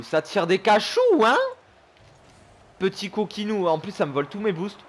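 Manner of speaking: usual speaking voice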